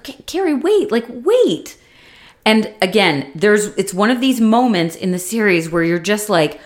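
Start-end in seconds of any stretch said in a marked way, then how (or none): 0.0-1.8 high, urgent calling after her; a man begging her to stop, voice pitched up; pleading. Retold or imitated. Big calls after Carrie as she walks off, high pleading.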